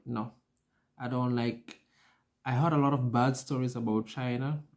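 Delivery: usual speaking voice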